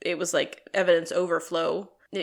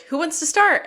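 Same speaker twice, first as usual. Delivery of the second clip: higher and louder, showman style; rallying call; 8 semitones higher, 8 dB louder than usual. Retold or imitated. Sean calls a vote among the band, higher and louder. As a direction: call out to the room like a host. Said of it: "And Sean is like".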